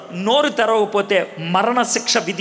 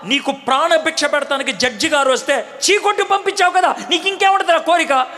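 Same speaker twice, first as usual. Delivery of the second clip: high, raised, scornful jeer ending in a laugh; mocking taunt; 8 semitones higher than usual. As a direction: rub his nose in it with a laugh. Jailer taunts the prisoner.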